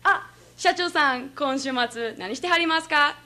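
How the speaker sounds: a hint of Kansai courtesy toward a boss; host father to company president. Host father inviting the company president out, politely imitated. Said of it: "お父さんが社長さんとお話をしてた時".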